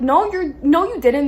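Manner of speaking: indignant denial. Retold or imitated. Acted quarrel with winner.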